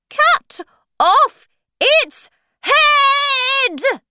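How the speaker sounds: very high, slow royal scream; furious shout. Acted. Queen ordering the Dormouse's execution, furious high shout. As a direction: shriek each word separately, last one drawn out. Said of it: "the queen shouted angrily".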